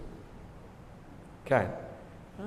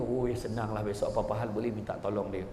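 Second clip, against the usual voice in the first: scheming hope; 7 semitones lower, 5 dB quieter than usual. Acted. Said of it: "dia ke".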